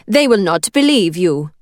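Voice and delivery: put-on firm, thick Italian accent. A narrator's reading. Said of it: "the thickness of her Italian accent".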